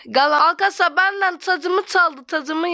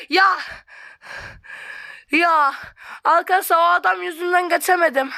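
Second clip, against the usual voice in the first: ordinary talk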